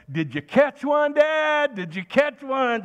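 put-on little girl asking dad, eager and high; excited little girl. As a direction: play his small daughter thrilled for dad. Little daughter running by.

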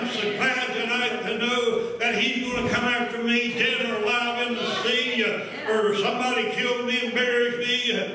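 high, driving scripture proclamation; full-force preaching. Real, not acted.

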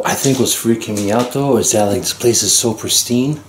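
normal talking voice

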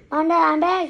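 high, loud homecoming call. A narrator's reading. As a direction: call to the parents as you arrive. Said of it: "he shouted".

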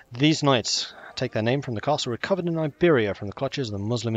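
grand announcer read; formal reading. Acted. Reads game description text.

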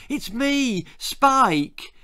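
put-on boy pleading with screaming girl; crying out. A narrator's reading. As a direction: cry out desperately to be recognised. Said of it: "he cried".

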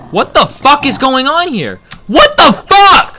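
high-pitched, exasperated outburst; real gamer rage. Real, not acted. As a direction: yell in disbelief at what just happened. Raging at a surprise in game.

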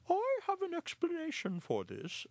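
very high, loud hooting owl; the owl cutting in. Acted. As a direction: announce it like a wise owl. Owl voiced.

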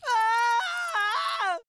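long frightened wail; terrified scream. Acted. Coward seeing so many of them, terrified scream. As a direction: scream in horror.

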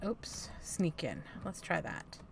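soft sneaky whisper. Real, not acted. Whispering about sneaking in game.

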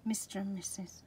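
soft fond whisper; hushed reveal of the pair. Real, not acted. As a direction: whisper it tenderly. Naming stencil pair.

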